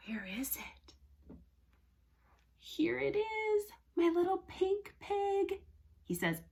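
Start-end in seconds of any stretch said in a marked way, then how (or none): 0.1-1.4 hushed, barely voiced muttering; searching whisper. Teacher looks for her toy cow, searching whisper. 2.6-5.6 high, soft little coo; delighted discovery. Pretend. Teacher shows her little pink pig, high soft coo. Finding the toy for children.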